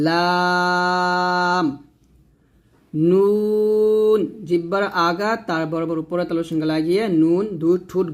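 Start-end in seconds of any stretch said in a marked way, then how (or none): 0.1-1.7 লাম drawn out thin and slow; light lam. Shown as a demonstration. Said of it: "লাম পাতলা করে". 2.9-4.2 নুন held long and even; drawn-out নুন. Shown as a demonstration. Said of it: "উচ্চারণ করতে হয়".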